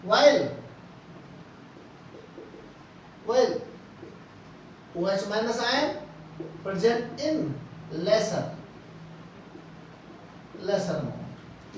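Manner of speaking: very slow, word by word for note-taking; slow dictation. Shown as a demonstration. Teacher dictating notes to students, very slow and deliberate. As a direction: dictate each word so students can write it down. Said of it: "लिख रहा हूं मैं".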